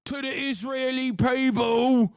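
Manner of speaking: high, slow, shouted caricature voice; mock tabloid rant. Mocking a Daily Mail writer with a high, slow shout. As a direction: bellow it like a hysterical tabloid columnist. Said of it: "He said".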